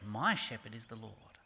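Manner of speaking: "My" lifted out of a low-pitched line; stress on MY. Shown as a demonstration. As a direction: hit "My" against the other person's "your", voice low. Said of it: "The emphasis is".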